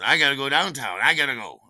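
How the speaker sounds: high, loud announcement; hurried excuse. Retold or imitated. Steven parting from friends, loud and hurried. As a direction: blurt it out, needing to leave.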